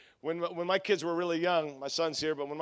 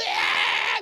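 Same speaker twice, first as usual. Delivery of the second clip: loud mock scream of panicked kids; imitated child's scream; 7 dB louder than usual. Dad retelling kids on the haunted ride, mock screaming. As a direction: scream like freaked-out little children. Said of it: "They started screaming".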